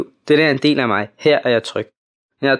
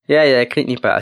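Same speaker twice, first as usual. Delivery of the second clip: usual speaking voice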